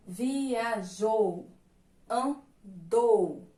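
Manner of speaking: "Viajou, andou" split into slow syllables, loud on the endings; drawn-out syllables. Shown as a demonstration. Teacher giving the class examples ending in U, drawn-out syllables. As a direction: sound out "Viajou, andou", stretching each final jou and dou.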